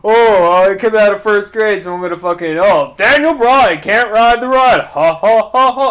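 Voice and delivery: high, loud mocking goofy voice; loud, high goofy mimicry; mocking impression. Mocking Big Cass promo.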